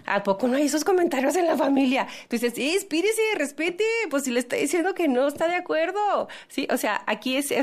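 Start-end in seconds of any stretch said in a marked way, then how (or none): 0.1-2.1 quick, lightly mocking protest; mock indignant question, rattled off quickly; teasing relative. Voices teasing relatives' reaction. 2.5-6.3 high-pitched scolding, hammed up; raised-pitch protest demanding respect; mock indignant retort. Acted retort to intrusive relatives.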